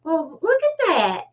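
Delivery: high, loud squeaky "Oh, well"; Edith Bunker. Retold or imitated. Hearing herself on her shorts, high Edith voice. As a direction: jump up high into a fussy sitcom-wife voice. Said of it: "and I hear myself".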